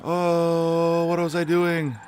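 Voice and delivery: sudden high startled yelp. Real, not acted. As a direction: caught off guard, jolt up high. Startled mid-race.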